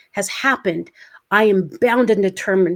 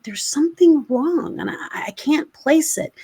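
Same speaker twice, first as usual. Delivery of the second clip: high, hushed, uneasy whisper; worried whisper to herself; 6 semitones higher than usual. Retold or imitated. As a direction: murmur it as if alone at the mirror. Worried self at mirror, whisper.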